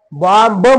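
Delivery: "बॉम्ब ब" with an added voiced B; wrong pronunciation. Shown as a demonstration. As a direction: stretch it to bom-buh in "बॉम्ब ब". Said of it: "म्हणू नका". ("बॉम्ब ब", ब जोरात उच्चारून)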